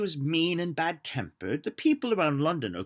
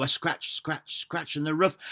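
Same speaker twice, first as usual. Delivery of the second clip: plain reading voice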